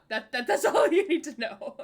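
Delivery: laughing through the words. Real, not acted.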